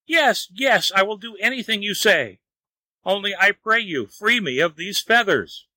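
high-pitched desperate plea; crying out. A narrator's reading. Wang begs the fairy to free him, crying out. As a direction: plead high, giving in completely. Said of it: "cried out at last".

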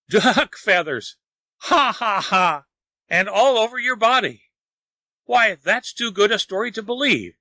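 high, mocking story-voice with acted ha-ha laughs; roaring laughter. A narrator's reading. Lin laughs at Wang's feathers, high mocking amusement. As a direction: scoff in amused disbelief, breaking into laughs. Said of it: "answered him only with a loud laugh, which burst into a roar".

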